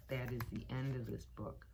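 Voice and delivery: usual speaking voice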